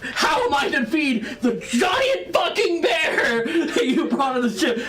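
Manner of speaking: high, exasperated tirade; angry. Acted. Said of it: "Orak is mad at you, and you can tell".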